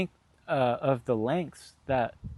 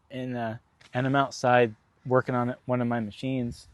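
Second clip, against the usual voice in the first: ordinary talk, nothing marked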